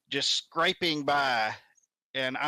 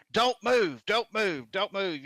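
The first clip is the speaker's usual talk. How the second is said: urgent call; 6 semitones higher, slower than usual. Retold or imitated. Bystanders calling into the overturned car, urgent then calmer.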